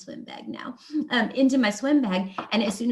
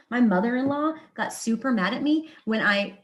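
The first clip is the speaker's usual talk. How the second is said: usual speaking voice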